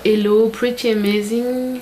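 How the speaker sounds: slow English with a French accent. Real, not acted. Vlogger reading out a little clip's message, French-accented English. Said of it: "Avec mon super accent".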